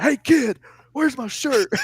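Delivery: high, loud voiced demand; lightly voiced angry wrestler; mock stern wrestler. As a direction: loom over the kid. Lance confronting trainee in story.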